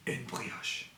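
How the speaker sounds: breathy whisper of une brioche. Shown as a demonstration. Teacher whispers the word for pupils to copy. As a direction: whisper it like a secret. Repetition drill in varied voices.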